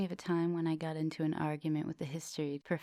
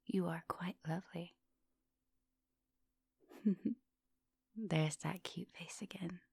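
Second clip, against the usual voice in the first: soft close whisper, right by the ear; intimate whisper; 4 dB quieter than usual. Acted. Vampire whispers to her student, soft close whisper. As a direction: breathe the words close and hungry.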